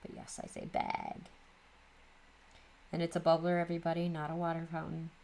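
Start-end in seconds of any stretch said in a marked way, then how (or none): 0.7-1.2 "bag" said like "bayg"; Midwest "bag". Shown as a demonstration. Confirming her accent to viewers, Midwest "bag" as "bayg". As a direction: give "bag" the Midwest raised vowel. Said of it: "My Midwest accent does appear".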